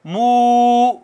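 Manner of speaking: slow, drawn-out chanted recitation; Quran recitation. Shown as a demonstration. Teacher reciting the verse for students, slow chanted recitation. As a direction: recite each word slowly, stretching the vowels. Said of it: "recites part by part".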